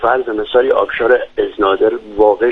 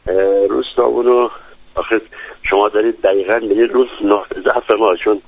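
ordinary delivery

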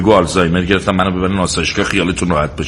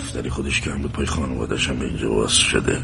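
low, soft grumble to himself; muttering inaudibly; 8 semitones lower, 6 dB quieter than usual. Acted. Husband grumbles about Safdari's family, muttering low. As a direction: mumble it so she can't quite hear. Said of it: "یه جوری حرف بزن من بشنوم چی میگی".

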